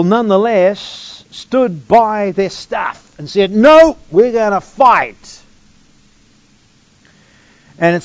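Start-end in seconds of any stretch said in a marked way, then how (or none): 3.5-5.4 louder, slow, firm "No, we're gonna fight"; defiant vow. Retold or imitated. Preacher voicing colonists who refuse to bow, defiant. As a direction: plant your feet and refuse.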